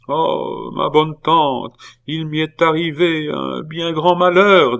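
high plaintive lament, drawn out; tearful wail. A narrator's reading. Nephew confessing misfortune to his aunt, tearful. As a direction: play a boy dissolving into tears. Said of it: "se mit à fondre en pleurs".

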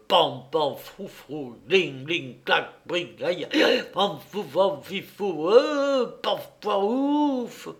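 string of comic mouthed noises, swooping high at the end; onomatopoeic din. A narrator's reading. Narrator imitating a drunken uproar, playful sound effects. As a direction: act out every bang, rustle and clink. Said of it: "même avec le secours des onomatopées".

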